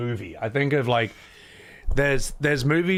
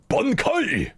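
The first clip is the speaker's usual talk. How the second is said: anime shout; 10 semitones higher than usual. Retold or imitated. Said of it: "what's it called".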